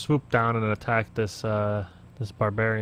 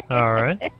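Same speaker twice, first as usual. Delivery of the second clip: laughing. Real, not acted. Still laughing after bear fart.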